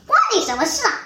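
mildly nasty brush-off; vicious retort. A narrator's reading. Wolf snarling at mother sheep, mild menace. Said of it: "老狼恶狠狠地说".

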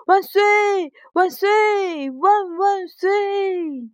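put-on high-pitched joyful child's hurrah; happy cheering. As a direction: cheer as a delighted little boy, stretching the end. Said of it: "阿文高兴地说".

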